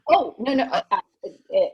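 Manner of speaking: stumbling, embarrassed giggle; flustered laugh. Acted. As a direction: caught off guard and laughing it off. Flustered by a misunderstood compliment.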